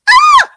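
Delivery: high, loud squeal of excitement; excited scream. Real, not acted. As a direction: squeal with joy. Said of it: "I'm excited".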